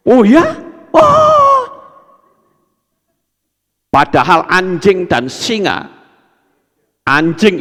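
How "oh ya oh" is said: delighted surprise. Acted. Flattered listener reacts with delight.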